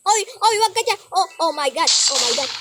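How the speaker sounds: ordinary talk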